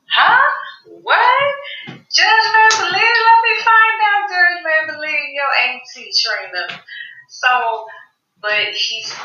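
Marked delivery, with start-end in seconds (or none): 0.1-6.8 stunned viewer reaction; shocked disbelief. Real, not acted. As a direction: react like you can't believe it. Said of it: "And I'm like".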